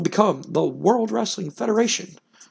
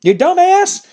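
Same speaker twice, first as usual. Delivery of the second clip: much higher voice with cackling laugh; Jim Cornette gloating; 14 semitones higher than usual. Retold or imitated. Manager mocking the men crawling out, high cackling taunt. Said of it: "He's like".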